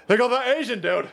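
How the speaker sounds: mock a clueless politician spotting someone; Joe Biden. Commentator mocks Biden wandering off, lightly hammed-up clueless voice. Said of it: "Joe Biden's such a dipshit. He goes".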